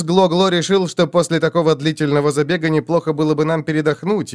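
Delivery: ordinary delivery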